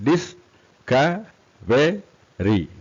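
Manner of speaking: four long separate beats on Discovery; syllable by syllable. Shown as a demonstration. Teacher demonstrates four syllables, syllable by syllable.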